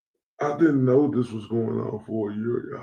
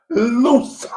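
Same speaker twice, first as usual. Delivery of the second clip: put-on Boston accent, loud, higher and drawn out on Loosa; mock Boston accent calling someone Loosa; 12 semitones higher, 5 dB louder than usual. To the chat, mocking a rival as a loser in a Boston accent. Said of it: "in my Boston voice".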